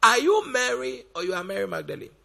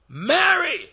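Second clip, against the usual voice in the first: calling out; 7 semitones higher, 5 dB louder than usual. Acted. Jesus calling Mary by name.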